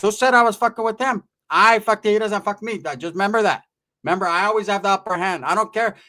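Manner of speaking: defiant mock-aunt retort; indignant aunt. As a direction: play the tia putting him in his place. Aunt snaps back indignantly.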